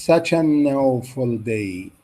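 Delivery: "such an awful day" said slowly; slow teacher demo; slow model reading. Shown as a demonstration. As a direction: stretch the phrase out for learners. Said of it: "copy the stress and intonation".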